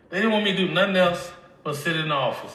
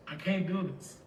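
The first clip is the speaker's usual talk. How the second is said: much softer, almost breaking; hushed despairing refusal; 2 semitones higher, 8 dB quieter than usual. Retold or imitated. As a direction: let the repeat sink into a soft, defeated murmur.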